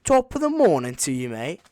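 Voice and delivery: loud, high-pitched mock Irish accent; mock Irish greeting accent. Gamer clowning after accent jokes, hammed-up Irish greeting. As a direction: belt out a hammed-up Irish greeting, dropping at the end.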